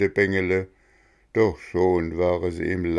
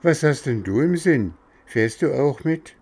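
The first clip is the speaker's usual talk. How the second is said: higher, sweet coaxing voice; coaxing coachman; 7 semitones higher, 4 dB louder than usual. Acted. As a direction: lure the boy in gently. Coachman coaxes Bengele.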